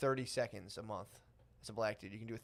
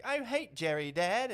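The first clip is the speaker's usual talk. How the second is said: put-on high, louder child voice; mock little kid; 8 semitones higher, 5 dB louder, slower than usual. English kid telling Dad after the book, high mock-child voice. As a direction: put on an indignant kid, pitched up.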